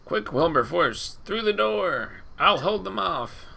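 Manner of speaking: high, heroic call to ally; heroic shout. Acted. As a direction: cry out bravely for the ally. Heroic character line in combat.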